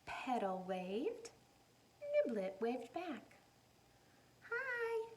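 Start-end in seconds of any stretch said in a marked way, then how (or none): 4.5-5.1 very high squeaky little hi; tiny doll voice. Acted. Doll Petal greeting the other doll, squeaky high. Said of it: "said Petal".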